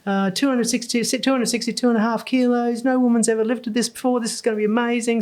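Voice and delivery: fast, high South African announcer; South African accent. Retold or imitated. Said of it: "had a South African accent".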